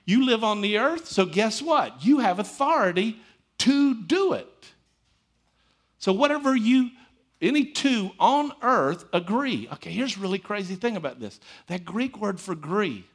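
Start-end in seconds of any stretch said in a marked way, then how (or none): 0.0-9.7 full-force preaching. Acted. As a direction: preach it out to the room with rising conviction. Preacher exhorting congregation.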